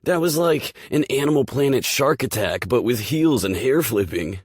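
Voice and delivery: high, loud, breathless amazement; excited gushing. Acted. Langley gushing to Rachel, thrilled. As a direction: rave about it, can't believe it.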